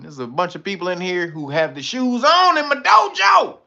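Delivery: shouting at chat about shoes in the dojo. Pretend. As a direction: yell at the room like a sensei. Calling out chat.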